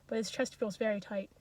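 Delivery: usual speaking voice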